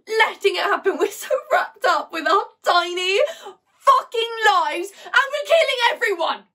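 panicked stage rant, spiralling upward; distraught monologue character. Acted. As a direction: build from urgent insistence to a high, anguished outburst. Performs monologue from Lungs.

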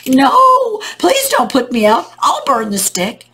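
high, loud wail easing into a plea; hammed-up storybook panic; crying out. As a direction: cry out in alarm, then bargain. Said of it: "the fire cried out".

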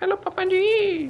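very high, slow put-on greeting; mock squeaky suitor. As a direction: greet the father in a silly falsetto. Playful suitor greets girl's father. (मज़ाकिया पतली, ऊँची आवाज़)

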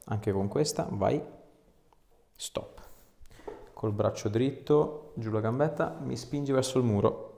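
ordinary talk

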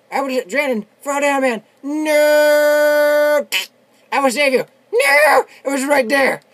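high, loud gamer yelling with a drawn-out No; desperate shouting. Real, not acted. Player yelling to save teammate Brandon, desperate shouting. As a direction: holler in real panic at the game. In-game panic as teammate goes down.

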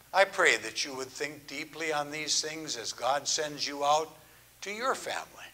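usual speaking voice

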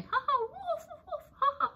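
very high barking laugh; dog's mocking laugh. A narrator's reading. The dog laughs at Shelley with a high barking laugh.

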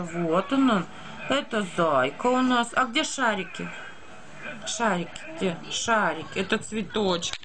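ordinary delivery

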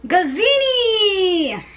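high, drawn-out cheer of a name; fan cheer. Pretend. Fan rehearses cheering for Gazzini, excited call. Cheering her favorite contestant.

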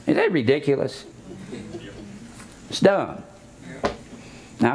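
ordinary delivery

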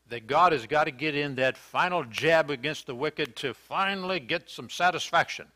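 usual speaking voice

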